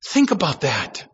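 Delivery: ordinary talk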